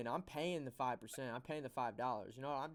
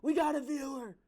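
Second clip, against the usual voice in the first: high, loud and thrilled; excited; 12 semitones higher, 10 dB louder than usual. Real, not acted. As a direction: announce it with a burst of glee. Excited at a viewer joining.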